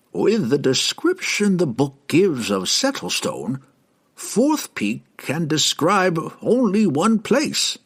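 put-on high-pitched, deliberate old scholar; old herald explaining. A narrator's reading.

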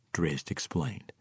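usual speaking voice

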